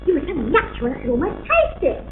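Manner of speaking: usual speaking voice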